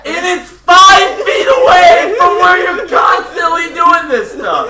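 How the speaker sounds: laughing shout. Acted. Exasperated roommates yelling, laughing.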